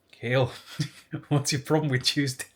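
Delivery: half-attempted Irish accent, breaking into a laugh; a giggly, barely-there Irish teacher voice; hint of Irish accent. Year head questioning a pupil, faint Irish accent with a laugh. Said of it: "he was Irish, and I can't do the accent".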